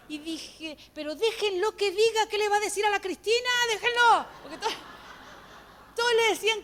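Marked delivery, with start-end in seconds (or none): 0.9-4.3 louder, higher pleading outburst; acted protest. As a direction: plead loudly to let him speak. Acts out her protest at the video.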